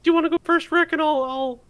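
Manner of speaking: whiny, anxious cartoon teen; nervous Morty voice. Morty reading the card to Rick, high and fretful.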